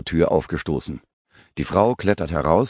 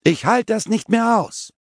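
screamed much higher, at the top of her voice; a furious woman screaming high. Acted. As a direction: let it burst out in a high scream. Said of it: "Dabei schreit sie".

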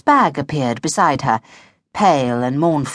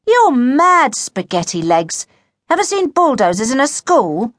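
high, loud, incredulous jeer; mock a friend's daft idea; scornful disbelief, pitched up; scoffing; 8 semitones higher, 4 dB louder, slower than usual. A narrator's reading. Boy scoffs at friend's claim.

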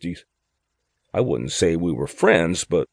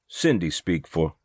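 ordinary delivery, nothing marked

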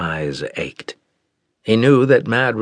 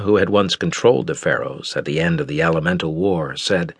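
usual speaking voice

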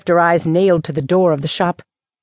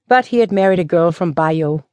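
ordinary delivery

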